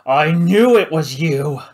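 loud, slow furious accusation; angry shout. Acted. The judge to Paladin, furious shout. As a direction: bark it at him.

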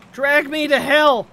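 slow loud anguished cry; dramatic wail. Real, not acted. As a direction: wail it out in despair. Game frustration cry.

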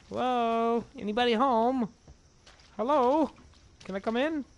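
high, loud call into a house; calling down a hallway. Acted. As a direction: call out to anyone inside.

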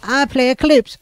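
high, put-on voice of a chatter; mock chatter voice. Streamer mimics a chat viewer who plays Eclipse in a high voice. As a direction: squeak it like a smug viewer.

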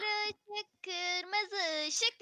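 very slow, drawn-out game call; oyun tekerlemesi. Shown as a demonstration. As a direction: stretch out every word of the call. (uzata uzata oyun komutu)

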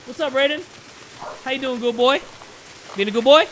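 high, playful pet-talk; talking to dog. Real, not acted. Greeting his dog.

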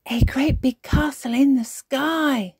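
put-on high, loud, excited little-girl voice; interrupting child. A narrator's reading. Peppa adding to Granny's story, excited. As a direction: add to the story with wonder.

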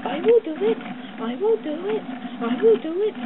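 high, loud, stressing will; determined puffing. A narrator's reading. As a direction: grit out I will do it with effort. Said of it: "puffed Edward".

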